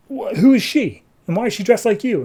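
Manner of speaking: high, stammered, incredulous question; baffled demand. Acted. Oliver asks Shadow about Komodo's daughter, baffled. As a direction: pitch it high and press for an answer.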